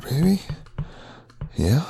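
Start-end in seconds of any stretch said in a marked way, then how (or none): 0.0-0.4 mocking. Mocking high question.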